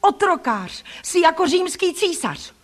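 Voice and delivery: high-pitched defiant outburst; outraged accusation. A narrator's reading. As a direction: hurl accusations at the bully. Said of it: "vykřikla jsem".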